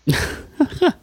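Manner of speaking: high-pitched giggle; high laugh. Real, not acted. Laughing at [name] joke.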